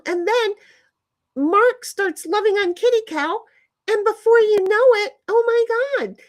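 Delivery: high-pitched, excited storytelling; amazed excitement. Real, not acted. Telling how the cat fell for a stranger, amazed. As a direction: gush in amazement, pitch held way up.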